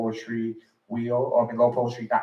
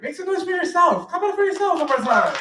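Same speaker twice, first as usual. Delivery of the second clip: high, excited crowd call; hype call; 4 dB louder than usual. Real, not acted. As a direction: pump up the room for applause. Host hypes crowd to applaud.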